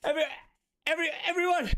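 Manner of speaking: high, loud call breaking into laughter; gleeful call. Acted. Apprentice wizard calling everyone to see his imp.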